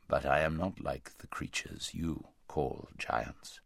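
low, slow, huge voice of a great lion; large deep voice. A narrator's reading. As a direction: speak low and vast, unhurried. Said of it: "said the large voice".

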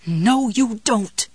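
slow, pressed defiant growl; through clenched teeth. Acted. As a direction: grit your teeth and push it out. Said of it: "she muttered through her clenched teeth".

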